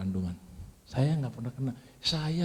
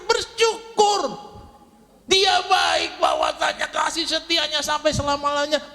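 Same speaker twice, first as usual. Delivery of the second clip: much higher, louder shouted praise; 13 semitones higher, 5 dB louder than usual. Shown as a demonstration. Preacher shouting thanks to show the church. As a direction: cry the praise out loud. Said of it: "Bersyukur tuh harus teriak".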